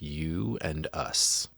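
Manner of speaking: lightly voiced demon, slow and drawn out; demon's reply. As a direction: stretch each word out slowly, a hint of demon. Acting the demon's menacing reply.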